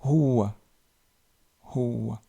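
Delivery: "Huwa" with a breathy h; teacher models "Huwa"; Arabic ha. Shown as a demonstration. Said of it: "c'est ha".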